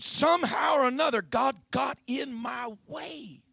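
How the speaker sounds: high, loud burst, stretching out the ending; emphatic testimony. Real, not acted. Preacher testifying about his conversion, fervent and emphatic. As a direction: lift the voice, then draw out the last words.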